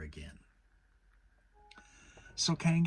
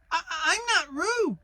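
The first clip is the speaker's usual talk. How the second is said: very high, louder squeaky protest; tiny piglet insisting, voice cracking; 9 dB louder than usual. A narrator's reading. Piglet protesting to Kanga that he is not Roo, loud squeak. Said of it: "said Piglet loudly".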